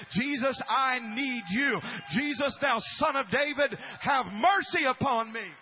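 preacher voicing Bartimaeus crying out; lifted-up voice. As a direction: lift your voice and plead. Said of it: "I'm gonna lift up my voice".

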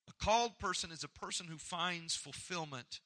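normal talking voice

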